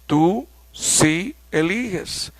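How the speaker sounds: slow, with "sí" pressed firmly; emphatic sí. Shown as a demonstration. Teacher translating the emphatic sí slowly. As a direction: insist slowly on "sí". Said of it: "una voz enfática".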